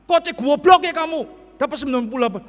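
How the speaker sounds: loud, high scolding mother; stern old-school parent. Retold or imitated. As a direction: grill the child about the score. Old-style parent scolding child. (ibu galak zaman dulu, keras dan tinggi)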